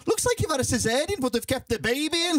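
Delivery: high, mocking Liverpool mate voice; Scouse accent. Scouser mate mocking his scar, in a Scouse accent. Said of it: "He's a Scouser".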